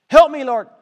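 man dying in the desert crying out; screamed plea. Pretend. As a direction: shout for help to God. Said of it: "And you're screaming".

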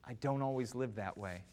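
ordinary talk, nothing marked

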